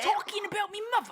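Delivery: high, loud cartoon Cockney; mock British accent. Friend joins the British impressions, squeaky mock accent. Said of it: "Can't do a British accent".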